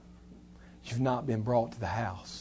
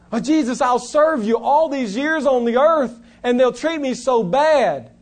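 complaining servant; 11 semitones higher, 12 dB louder than usual. Retold or imitated.

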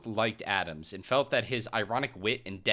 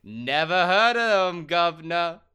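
loud, high, drawn-out mock British; Jonathan Adams, hammed up; 6 semitones higher, 7 dB louder than usual. Actor answers his agent, loud hammed-up reading. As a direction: bellow it slowly in a joke British accent. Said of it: "that's exactly how Jonathan Adams sounds".

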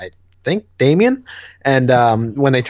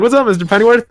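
put-on goofy young guy voice, pushed higher and louder; exaggerated silly voice; 10 semitones higher, 4 dB louder, faster than usual. Rick greeting Alfred again, hammed-up goofy voice. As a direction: ham up the silly voice even more. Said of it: "I don't know why I made him sound like that".

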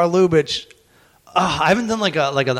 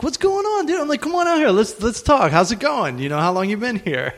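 excited greeting; 8 semitones higher than usual. Retold or imitated. Welcoming a newcomer to town.

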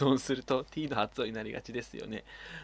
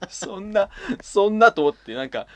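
normal talking voice

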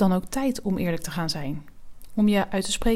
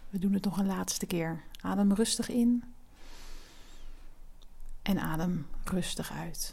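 soft spa whisper; 5 dB quieter than usual. Acted. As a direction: whisper them through each breath. Guided breathing exercise.

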